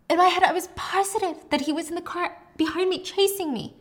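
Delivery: frantic, scared of being chased; patient describing her fear of being followed; panicky. Retold or imitated. Patient recounting paranoid panic.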